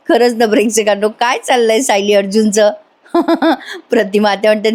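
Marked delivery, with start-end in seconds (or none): 3.1-3.9 brief high chuckle; amused laugh. Real, not acted. Narrator asking viewers about Sayali and Arjun, amused chuckle.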